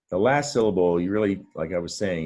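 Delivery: normal talking voice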